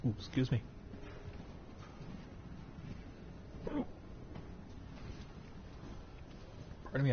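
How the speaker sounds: hiccuping mid-sentence; hiccups. Real, not acted. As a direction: talk through hiccups. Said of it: "I got the hiccups".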